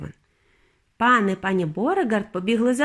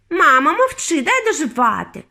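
sharp, raised retort from a daughter; irritated brush-off; 6 semitones higher, 5 dB louder than usual. A narrator's reading. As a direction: shut your fussing mother up.

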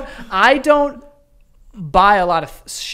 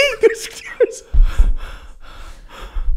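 long breathless laugh; helpless laughter; laughing fit. Real, not acted.

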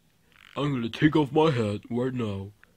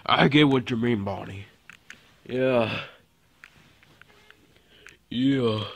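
usual speaking voice

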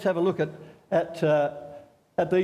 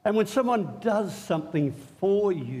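ordinary delivery

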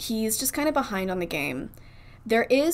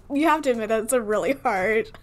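ordinary talk

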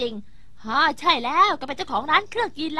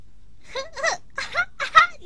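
mocking laugh; 9 semitones higher than usual. Mocking Doraemon's straw.

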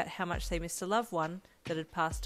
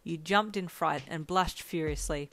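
plain reading voice

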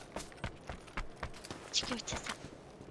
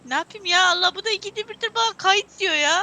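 loud, fed-up outburst, higher pitched; exasperated shout; 7 semitones higher, 14 dB louder than usual. Real, not acted. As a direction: throw up your hands and yell it. Frustrated at recording prompt.

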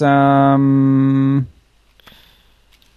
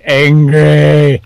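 slow, groaning zombie voice; zombie impression; 3 semitones higher, 4 dB louder than usual. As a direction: drag it out like a shuffling zombie. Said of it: "That was, like, a zombie impression".

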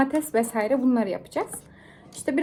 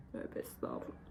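faint breathy whisper; 20 dB quieter than usual. Real, not acted. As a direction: barely breathe the words. Embarrassed pious muttering. (fısıltıyla)

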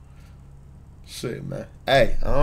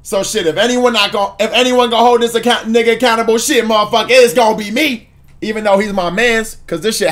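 higher and louder, riled up; heated rant; 6 semitones higher, 3 dB louder than usual. Real, not acted. Streamer vowing to hold his friend accountable, heated and loud. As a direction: rant it like you mean it.

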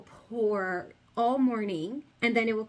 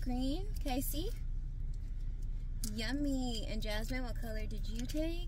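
soft sweet mom voice; 3 semitones higher, 7 dB quieter than usual. Real, not acted. Mom cooing softly to her kids in the car. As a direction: keep it gentle and cooing for the little ones.

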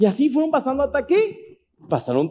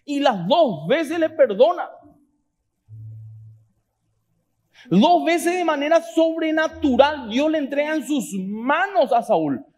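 higher-pitched, emphatic sermon delivery; fervent preacher, stretching key words; impassioned preaching; 7 semitones higher, slower than usual. Real, not acted. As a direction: drive the point home to the congregation. Preaching at rising force.

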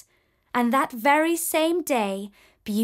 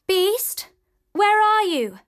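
high, louder call through the halls; 9 semitones higher, 4 dB louder, slower than usual. A narrator's reading. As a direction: call out searching for him. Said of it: "she called".

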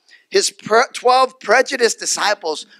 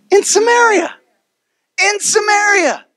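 preaching climax; 12 semitones higher, 4 dB louder than usual. Real, not acted.

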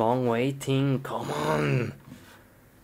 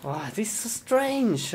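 normal talking voice

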